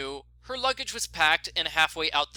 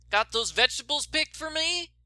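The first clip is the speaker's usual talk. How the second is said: Shorty's voice; 10 semitones higher than usual. Acted.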